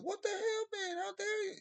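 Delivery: squeaky put-on outrage; mock high-pitched outrage. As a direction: pitch it way up in fake indignation. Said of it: "We're like".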